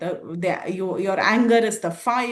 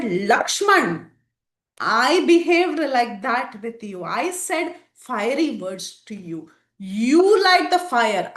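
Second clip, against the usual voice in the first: slow, loud, earnest plea to light the fire; pleading Sita; 3 semitones higher, 5 dB louder, slower than usual. Acted. Sita begs Lakshman to light the fire, slow and earnest. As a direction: let each word weigh like a confession.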